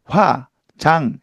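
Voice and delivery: 화, 장 said slowly as solid syllables. Shown as a demonstration. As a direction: chop into full syllables. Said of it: "글자 발음해줘야지".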